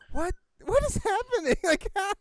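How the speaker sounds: high, loud mock disbelief; baffled exclamation. Retelling her own reaction to the noisy woman, incredulous and high. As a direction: act totally baffled by her noise. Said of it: "I was like".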